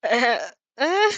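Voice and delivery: stall, unsure what to say; uncertain. A narrator's reading. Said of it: "let out of an uncertain".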